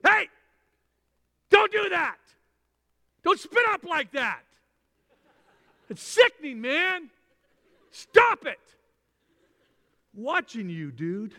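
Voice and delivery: high, loud mock scolding; mock scolding parent. Nitpicking parent scolding the baby for spitting up. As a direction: bark at the baby in fake disgust.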